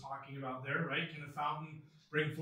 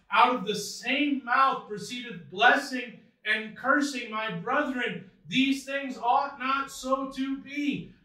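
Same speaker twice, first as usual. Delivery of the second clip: higher, louder emphatic Bible reading; preacher's raised reading voice; scripture reading; 6 semitones higher, 8 dB louder than usual. Acted. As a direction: read the verse out forcefully to the congregation. Reads scripture aloud emphatically.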